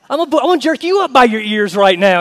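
hammed-up angry mama; threatening mama. Mary, as a sassy mama, threatening to jerk her son by the ears.

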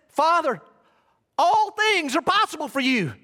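high, loud cry to God; impassioned shouted prayer; calling out loudly. Acted. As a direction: call it out at full preaching force. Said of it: "calling out loudly".

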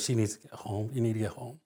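very soft, low and grave; low, fading almost to nothing; hushed retold plea. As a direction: barely voice the relative's plea. Aunt's words about dying mother retold.

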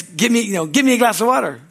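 put-on bossy child ordering a drink; rude demand. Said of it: "you sure gotta ask right".

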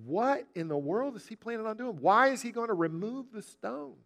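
high, hushed disbelief; softly incredulous crowd voice; puzzled onlookers. Retold or imitated. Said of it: "People must think".

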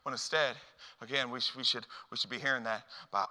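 normal talking voice

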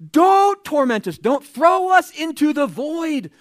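high, slow, loud screechy demon voice; shrieking demons. Acted. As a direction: wail it like a cornered demon pleading. Demons begging Jesus not to torment.

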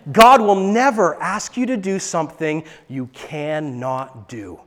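ordinary talk, nothing marked

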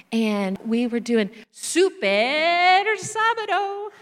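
"Super Sabado" drawn out slowly and sung high; savoured Spanish. Shown as a demonstration. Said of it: "you have to say it like that".

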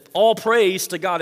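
call to praise. Retold or imitated. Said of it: "calling them to praise".

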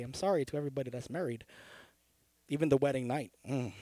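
soft, fast throwaway apology; quick quiet aside. Real, not acted. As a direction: toss it off under your breath. Quick joking aside to congregation.

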